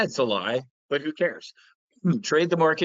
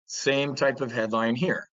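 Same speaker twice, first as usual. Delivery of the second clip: usual speaking voice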